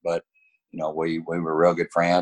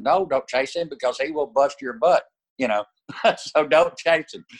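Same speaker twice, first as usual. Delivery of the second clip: laughing while quoting; 9 semitones higher than usual. Retold or imitated. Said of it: "And I said".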